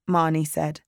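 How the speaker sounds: plain reading voice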